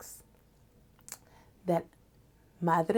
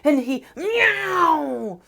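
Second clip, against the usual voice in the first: angry meow; 7 semitones higher, 5 dB louder, much slower than usual. Acted. Cat filled with rage.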